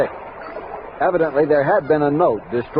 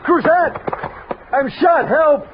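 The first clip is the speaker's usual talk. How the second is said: high, pained shout; desperate cry for help; 13 semitones higher, slower than usual. Acted. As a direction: cry out just after being shot. Wounded man calling for help.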